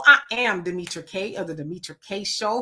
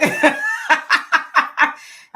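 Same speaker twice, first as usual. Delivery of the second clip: high, real burst of laughter; laughing; 5 dB louder than usual. Real, not acted. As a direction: break into a long, high laugh. Laughs after quoting her daughter.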